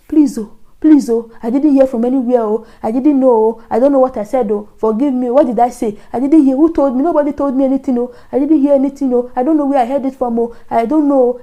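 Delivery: plaintive, tumbling pleas; retold frantic plea of innocence; begging. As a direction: act out desperate begging, words running together. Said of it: "Hey, I was begging".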